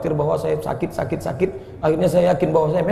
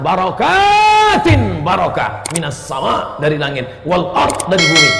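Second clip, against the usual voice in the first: higher and louder, quoting scripture line by line; preaching at full force, verse by verse; fervent preaching; 6 semitones higher, 4 dB louder than usual. Acted. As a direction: build each verse phrase higher.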